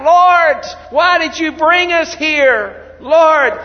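loud, high desperate plea to God; anguished crying out. Acted. Joshua fallen to the ground, crying out to God, loud and desperate. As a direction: cry out to the Lord in despair. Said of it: "they're crying out".

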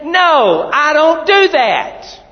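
loud, high, slow and forceful; vigorous no. Shown as a demonstration. Preacher demonstrating a forceful refusal for the congregation. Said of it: "Say it with vigor".

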